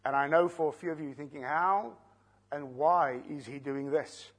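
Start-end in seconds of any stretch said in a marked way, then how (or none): none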